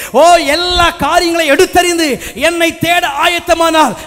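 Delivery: full-force preaching. Acted. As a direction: preach it out to the whole church. Preaches to the congregation. (முழு வேகத்தில் உரத்த பிரசங்கம்)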